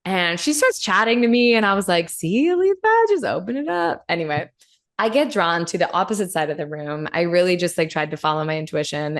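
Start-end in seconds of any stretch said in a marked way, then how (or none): 2.1-4.0 high self-coaching voice. Retold or imitated.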